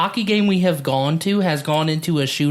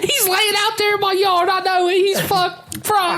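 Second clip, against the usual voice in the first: mock panic. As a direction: play the customer shrieking into the phone. Voicing panicked customer calling cops.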